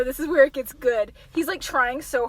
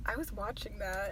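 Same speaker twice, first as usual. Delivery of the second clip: low, very soft acted murmur; murmured flirtation; 5 semitones lower, 17 dB quieter than usual.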